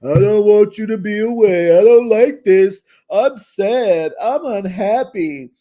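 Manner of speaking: loud, high, whiny mock pity party; mock whiny Travis. Mocking a man whining to Gina, loud whiny voice. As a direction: whine it like a pouting grown man. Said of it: "you having a pity party".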